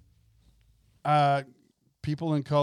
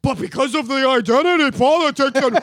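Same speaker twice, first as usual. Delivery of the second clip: much higher, louder, sing-song sarcastic parody; sarcastic mock voice; 9 semitones higher, 8 dB louder than usual. Co-host parodying woke writers, sarcastic sing-song voice. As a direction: ham up the preachy inclusive line. Co-host mocks identity politics.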